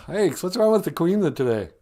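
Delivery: usual speaking voice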